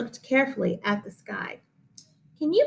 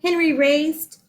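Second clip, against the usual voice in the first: usual speaking voice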